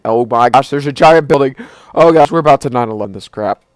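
loud alarmed yelling; panicked shout. Real, not acted. Giant building ahead, panic.

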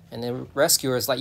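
ordinary talk

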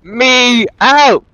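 very slow, each word stretched out; drawn-out demand. Pretend. Trapped player demands release from crew, slow and drawn out. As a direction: spell out the demand word by word, slowly.

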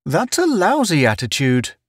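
high-pitched cheery scolding; cheerful. A narrator's reading. Town chiding Pace's attitude, high and cheerful. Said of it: "Town said cheerfully".

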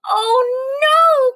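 high, loud dismayed cry; a hen's upset little cry; cried out. Acted. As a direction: wail it as a hen in dismay. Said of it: "cried Dora".

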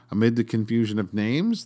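usual speaking voice

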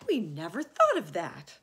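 loud exclamation of realisation; amazed outburst; astonished cry. Acted. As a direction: cry out as it dawns on you. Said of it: "they cried".

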